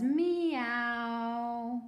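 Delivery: long drawn-out teacher's "Meow"; slow, sustained kitty meow; cat's meow. Shown as a demonstration. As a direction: say a stretched cat meow, landing on ow. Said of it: "this is what a cat says".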